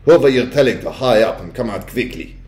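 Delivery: suspicious witch with a put-on accent; snapped. A narrator's reading. Witch interrogating grandmother about her shouting, snapping. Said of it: "snapped the Grand High Witch".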